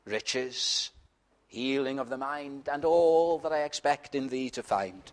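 soft, hushed recited hymn lines; quiet hymn prayer. Shown as a demonstration. Preacher quoting a hymn to Christ, softly. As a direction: say it low and humble, like a whispered vow. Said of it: "you look to Christ and you say".

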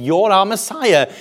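high-pitched acclamation; acclaiming crowd. Acted. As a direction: hail him as the crowd would. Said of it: "They're saying".

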